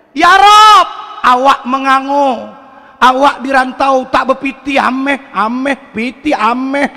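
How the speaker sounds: tiruan logat Minang, lebih tinggi dan keras; doa orang Padang. Retold or imitated. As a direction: meniru perantau Padang berdoa minta emas. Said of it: "Orang Padang baca doa beda". (logat Padang yang dilebih-lebihkan)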